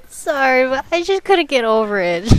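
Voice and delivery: ordinary delivery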